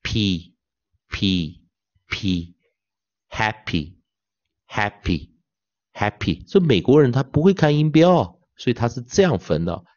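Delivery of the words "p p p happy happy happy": very slow "P, P, P, happy, happy, happy", hap stressed; teacher drilling syllable then whole word. Shown as a demonstration. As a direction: build from "P" to "happy" slowly. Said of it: "然后这边什么".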